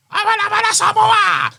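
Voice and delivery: high, loud shouted Umaga chant; mock Samoan war cry; Umaga impression. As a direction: belt it out like Umaga. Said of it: "if Umaga saying something".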